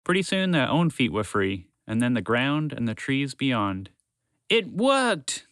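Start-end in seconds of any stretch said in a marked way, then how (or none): 4.5-5.5 high triumphant cry; exclaimed. Acted. The red dragon to the others, high triumphant exclaim. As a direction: celebrate the success.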